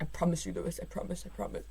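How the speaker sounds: imitating the team boss, dropping to a soft hush; mock-soothing, voice sinking on each promise. Podcaster mimicking the boss pleading with his driver, hushed. Toto quoted reassuring Lewis.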